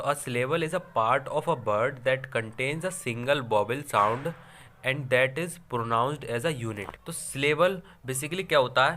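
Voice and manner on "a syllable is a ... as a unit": very slow, even dictation of a definition; dictating a definition to students, slow and even. Shown as a demonstration. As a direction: read it out word by word for notes. Reads definition slowly, dictation.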